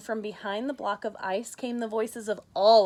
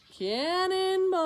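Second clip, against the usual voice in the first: splashy cannonball cry; 10 semitones higher, 5 dB louder, slower than usual. Acted. Tacky does a splashy cannonball.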